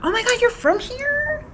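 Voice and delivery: high shocked tourist squeal; mock amazed. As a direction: overdo the disbelief.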